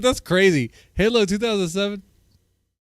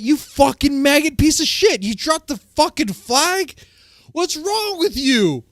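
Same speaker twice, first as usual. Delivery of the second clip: raging gamer; 7 semitones higher than usual. Retold or imitated. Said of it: "They're like".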